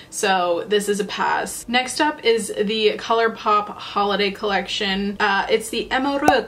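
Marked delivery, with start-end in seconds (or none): 5.9-6.5 French-accented name, stumbling try; attempted French accent. Shown as a demonstration. Makeup reviewer naming a collection, attempted French accent. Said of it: "like, a decent French accent".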